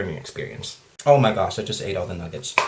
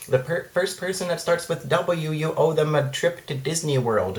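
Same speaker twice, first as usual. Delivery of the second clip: higher, slow, sing-song reading of a silly post; mocking Facebook chain message, higher and slower; 7 semitones higher, slower than usual. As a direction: read the post like a gushing scam. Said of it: "It's just, like".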